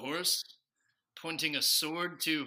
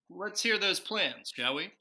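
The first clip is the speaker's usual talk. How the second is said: ordinary talk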